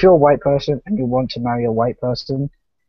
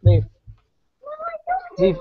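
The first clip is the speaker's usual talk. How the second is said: raised, high repeated get out; shooing someone out; 7 semitones higher, 3 dB louder, faster than usual. Real, not acted. Shooing a kid out of the room.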